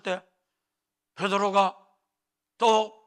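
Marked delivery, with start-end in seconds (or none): none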